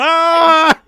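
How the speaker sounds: high, loud imitated movie scream; mock scream. Retelling the movie victim's handless scream, mock scream. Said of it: "stands there with no hands going".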